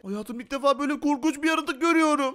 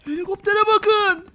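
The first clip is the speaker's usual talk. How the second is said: loud, higher-pitched call; startled alert; 6 semitones higher, 5 dB louder than usual. Pretend. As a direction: point it out excitedly to viewers. Spots a strange helicopter. (heyecanla haber verme)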